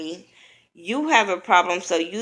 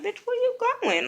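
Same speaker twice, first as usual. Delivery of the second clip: far higher, disbelieving; stunned call; 4 dB quieter than usual. Retold or imitated. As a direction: shout after her in disbelief. Replaying the same stunned call.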